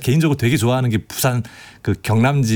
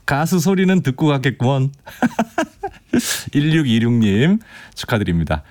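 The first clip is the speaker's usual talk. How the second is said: normal talking voice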